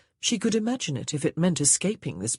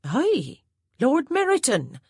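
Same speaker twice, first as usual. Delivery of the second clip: higher, louder surprised exclamation; surprised maid; 10 semitones higher, 5 dB louder, slower than usual. A narrator's reading. Maid Hattie, surprised at her mistress, exclaiming louder. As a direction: blurt the name out in surprise.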